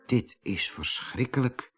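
hushed, low thought; horrified thought. Acted. Koosje thinking to himself, hushed dismay. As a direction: think it aloud, softly, in dismay. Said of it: "dacht Koosje".

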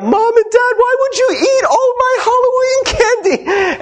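very high, wailing mock-child voice; mock crying, high-pitched protest; imitating bawling kid. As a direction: wail like a betrayed little kid, hammed up. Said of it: "they're crying and they're bawling".

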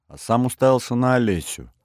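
ordinary delivery, nothing marked

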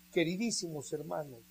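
usual speaking voice

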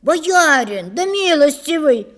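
high, loud plea to a master; protesting cry. Acted. Serf protests his horse being taken.

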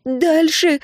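high-pitched squeal; shrieked urging. A narrator's reading. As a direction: squeal it out urgently. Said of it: "взвизгнула Энн". (взвизгнув, высоким голосом)

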